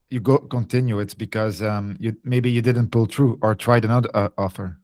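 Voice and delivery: ordinary talk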